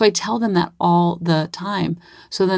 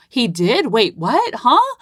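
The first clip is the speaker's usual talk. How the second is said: mock shocked; 6 semitones higher, much slower than usual. Mom hearing the doctor's rule, mock shocked. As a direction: act stunned at the news.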